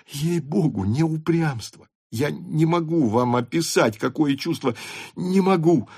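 put-on high, distressed young man's appeal; earnest pleading. Rostov pleads he is not stubborn. (умоляюще, высоким голосом)

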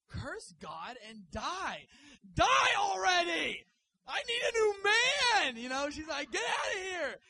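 furious screaming, voice cracking high and loud; screaming wife. Retold or imitated. Job's wife screaming at her suffering husband, furious shriek. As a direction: shriek at him like a fed-up wife. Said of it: "she said".

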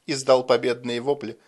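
ordinary delivery, nothing marked

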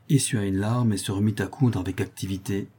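ordinary delivery, nothing marked

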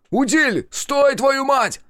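high, loud yell after someone escaping; drawn-out high-pitched furious call; desperate shout. A narrator's reading. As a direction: bellow his name, then curse. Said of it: "закричал я".

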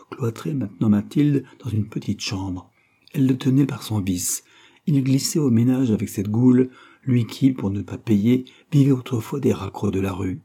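ordinary delivery, nothing marked

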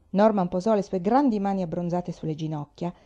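usual speaking voice

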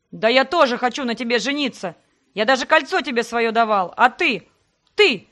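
loud growling outburst rising; growled. Acted. Sebastian growls at her while shaking her. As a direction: growl it, losing words at the end. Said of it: "рыкнул он".

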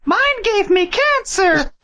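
put-on high, slow goofy kid voice; Ralph Wiggum. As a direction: say it like a dopey little kid. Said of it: "some Ralph Wiggum kid being like".

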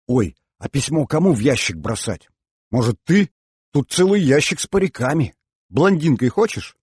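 put-on animated girlish urging; excited suggestion. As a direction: pitch an idea to a friend eagerly. Girls eagerly plan who posts letter.

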